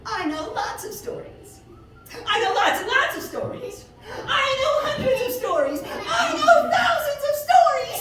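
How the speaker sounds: high, laughing, bragging hare voice that builds to a shout; boastful hare. A narrator's reading. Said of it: "And that hare said".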